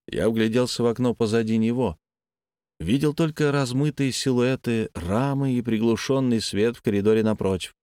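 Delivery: ordinary delivery, nothing marked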